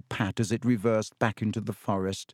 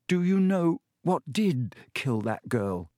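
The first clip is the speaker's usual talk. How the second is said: put-on slow, insistent question in a boy hero's role; lightly voiced Harry; 3 semitones higher than usual. A narrator's reading. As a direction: ask it slowly, lightly in character. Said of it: "said Harry".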